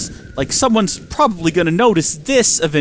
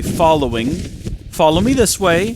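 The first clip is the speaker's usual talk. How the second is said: normal talking voice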